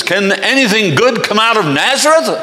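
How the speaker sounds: loud, high scornful challenge; not very gentle. Acted. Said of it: "not very gentle with Jesus".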